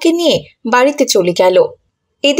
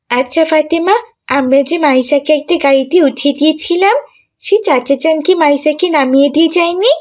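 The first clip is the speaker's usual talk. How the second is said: put-on lightly raised girlish voice, rising at the end; questioning schoolgirl; 4 semitones higher than usual. Schoolgirl asking her friend about the man with the car, questioning.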